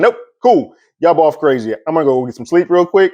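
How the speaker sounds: high, dismissive imitation of Franklin; Franklin brushing it off. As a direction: scoff at them and head off to bed. Franklin voiced in retelling.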